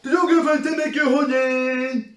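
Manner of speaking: high, very loud mock voice; mock questioner. Mocking the stupid question she asked, high loud mock shriek.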